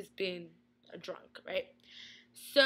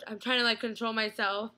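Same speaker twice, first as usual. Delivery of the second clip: usual speaking voice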